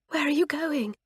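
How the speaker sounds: hoarse, breathy whisper. A narrator's reading. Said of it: "whispered Fern hoarsely".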